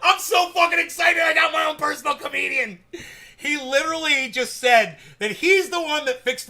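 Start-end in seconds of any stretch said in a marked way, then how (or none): 0.0-2.8 real thrilled shouts, much higher and louder; overjoyed streamer shouting about a fan's joke; excited shouting. Real, not acted. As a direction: yell with pure delight at the joke.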